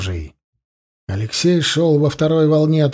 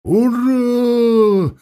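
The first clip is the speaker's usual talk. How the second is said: long, high-pitched hurrah; drawn-out rallying cry of charging riflemen; battle cry; 8 semitones higher than usual. A narrator's reading. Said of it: "с громким кличем".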